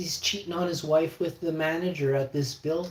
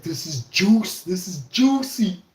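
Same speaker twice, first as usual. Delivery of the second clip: slow, higher, louder relish; gleeful gossip; 7 semitones higher, 6 dB louder, slower than usual. Retold or imitated. Imitating the maintenance guy savouring the gossip, slow and higher. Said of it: "He's like".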